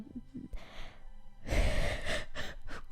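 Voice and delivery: long, mostly voiceless laugh; breathy laugh. Real, not acted. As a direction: dissolve into a soft, airy giggle. Loses track and laughs.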